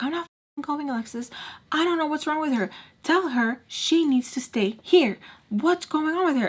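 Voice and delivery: high-pitched retold protest; exasperated refusal. Tommy refusing to go along with the mom, high and exasperated.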